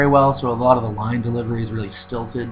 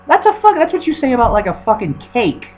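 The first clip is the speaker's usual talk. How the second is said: outraged squeal; 12 semitones higher than usual. Real, not acted. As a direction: shriek at how silly it sounds. Ranting at the review wording.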